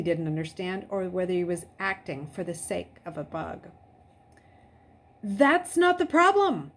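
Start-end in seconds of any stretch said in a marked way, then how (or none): 5.2-6.7 high, loud near-scream; almost screaming it. A narrator's reading. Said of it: "Luke almost screamed".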